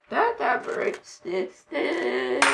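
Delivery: very slow, drawn-out ending; playful intoning. Pretend. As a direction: drag out every word theatrically. Child completes the intoned meme.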